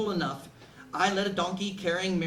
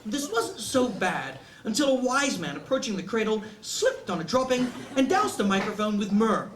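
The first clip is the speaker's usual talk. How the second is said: plain reading voice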